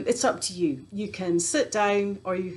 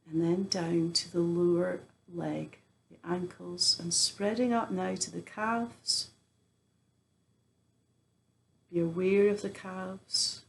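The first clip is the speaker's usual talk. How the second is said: guided meditation; 4 dB quieter than usual. Acted. Teacher guiding listeners through a body scan, guided meditation. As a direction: ease them gently through the body, pausing often.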